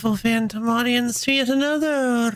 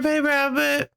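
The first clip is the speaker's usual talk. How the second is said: ordinary delivery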